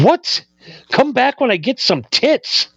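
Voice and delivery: put-on high, squeaky "what?" of a shocked kid; stunned disbelief. Retelling the drummer's insult, stunned and squeaking high. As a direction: sound floored, disbelief climbing high.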